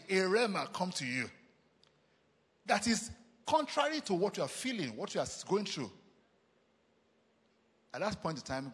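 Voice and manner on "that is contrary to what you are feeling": usual speaking voice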